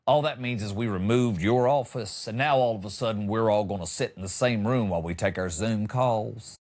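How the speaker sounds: ordinary delivery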